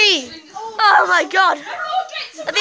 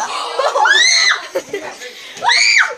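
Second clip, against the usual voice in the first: shrieking laugh. Real, not acted. Friend hurt, panicked laughter.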